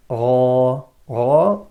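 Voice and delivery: Ra with a thick upward sound; loud heavy Ra. Shown as a demonstration. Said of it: "sound is going upwards".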